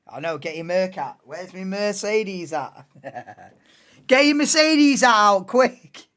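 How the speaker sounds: normal talking voice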